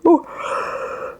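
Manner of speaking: long, breathy, unvoiced yawn; big yawn. Real, not acted. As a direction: let a huge yawn take over. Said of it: "that yawn overtook my life".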